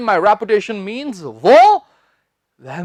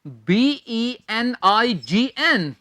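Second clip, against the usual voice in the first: slow spelling; slower than usual. Shown as a demonstration. Teacher slowly spelling for students to note down. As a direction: slowly spell each letter out. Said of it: "students note कर लीजिए".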